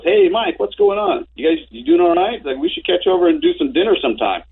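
high, breezy friendly hail; friendly John. Retold or imitated. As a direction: play it all cheery as if nothing happened. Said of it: "And he goes".

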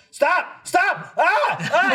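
high frantic yelling stop; panicked yell. Retold or imitated. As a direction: yell it in a panic, pinned in the seat. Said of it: "I'm like".

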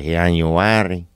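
put-on drawn-out Spanish-accented "January".

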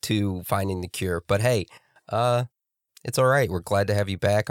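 normal talking voice

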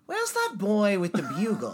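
high, grand British accent. Shown as a demonstration. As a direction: put on a plummy theatrical British voice. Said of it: "So British?".

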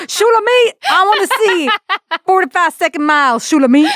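high barking mock coach yell; mock gym coach. Teasing her friend as a pushy PE coach, mock yell. Gym teacher voiced in Hebrew class joke.

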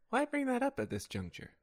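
high-pitched, lightly wounded query; mock affronted question. Rehearsing a reversal for a questioner, high and mock-affronted. Trying takes of a reversal line.

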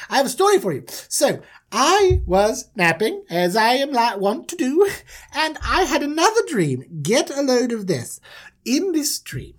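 high, showy storyteller; self-important dreamer. Acted. Manager tells Dad of another dream, high and pompous. [name] performs for his dad.

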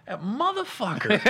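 high, slow mock resentment; acted-out jealous grumble; envious cursing. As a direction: curse a luckier friend, drawn out and high. Envious reaction to friend's picture.

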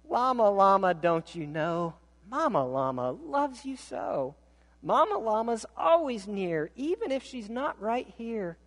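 gentle reassuring read; soothing mama. Acted. Mama llama comforting her child, tender reassurance.

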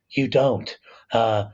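ordinary talk, nothing marked

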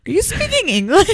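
baffled Lyft driver, pitched well up through a laugh; lightly voiced driver asking about the accent; confused driver. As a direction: play the puzzled driver, half laughing. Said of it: "one of the Lyft drivers was like".

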